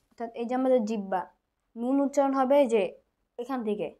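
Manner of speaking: normal talking voice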